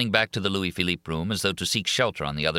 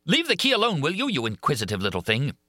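angry; 8 semitones higher than usual. A narrator's reading. Monster snapping at Christine.

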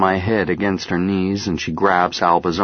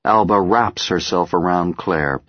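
ordinary delivery

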